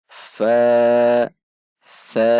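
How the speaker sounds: "Fa" dipanjangkan dua ketuk, lebih keras; mad tabi'i. Shown as a demonstration. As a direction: tahan vokal a pada "Fa". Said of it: "dibaca mat tabi'i dua ketuk". ("Fa" panjang bernada datar)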